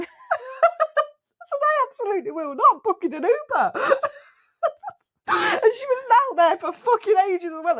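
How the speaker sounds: high-pitched laughing talk, words breaking up; can't hold it together while telling it. Real, not acted. Recalling refusing the proposal, laughing hard.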